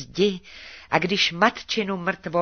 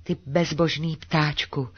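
plain reading voice

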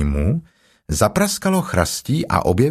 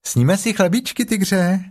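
put-on high, childlike little Roo; chirpy little joey asking a question; little kangaroo voice; 9 semitones higher than usual. A narrator's reading. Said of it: "a pak řekl". (vysoký dětský hlásek klokánka)

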